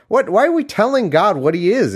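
high-pitched, incredulous ranting; exasperated outburst. Real, not acted. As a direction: burst out in disbelief. Exasperated rant at prayer.

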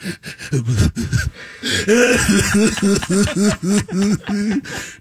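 a long rising star-struck giggle; giddy laugh. Retold or imitated. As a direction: laugh like a kid meeting heroes. Fan looking up at two stars.

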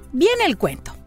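ordinary delivery

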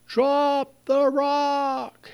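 high, drawn-out call from the boat; mock yell. Rescuers in the boat calling to the drowning man, drawn-out yell. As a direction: stretch it out like calling across water. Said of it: "the people in the boat yell".

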